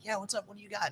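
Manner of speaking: high, quiet mocking voice of the guy; guy at the window. Salesman mimics the guy at the window, high and quiet. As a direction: talk up high like a lazy guy behind glass.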